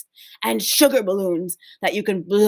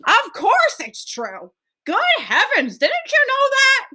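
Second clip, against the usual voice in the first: excited grandparents. Acted. Grandparents exclaim.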